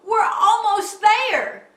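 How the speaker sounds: high, loud urgent holler; anxious call. A narrator's reading. Rescuer nearing Jubal's toadstool, anxious holler. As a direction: shout encouragement across water. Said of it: "an anxious voice called".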